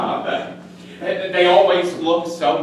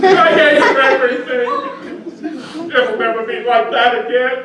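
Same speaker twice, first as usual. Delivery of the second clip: breaks into loud, high sobbing words; crying outburst; 6 semitones higher, 5 dB louder, faster than usual. Acted. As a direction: let the tears burst through the words. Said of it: "a rare quality in a man to be able to cry".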